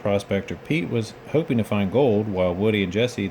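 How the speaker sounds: usual speaking voice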